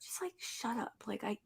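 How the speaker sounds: breathless whisper; barely voiced, overcome; choked whisper. Real, not acted. Fan overcome by the line, choked whisper.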